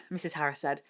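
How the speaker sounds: plain reading voice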